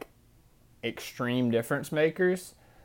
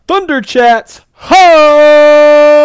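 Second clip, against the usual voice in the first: show intro shout; 24 dB louder, much slower than usual. Pretend. Announces the show's special edition.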